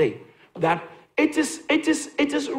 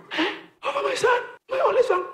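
much higher, incredulous cry; shocked disbelief; 13 semitones higher, faster than usual. Acted. Abraham to God, high incredulous protest.

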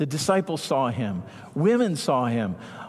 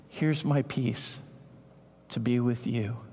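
Jesus softly offering peace to Thomas; tender offering; 6 semitones lower, 4 dB quieter than usual. Acted. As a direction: hand it over gently like a gift.

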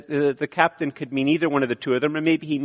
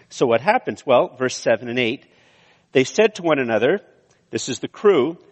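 ordinary talk, nothing marked

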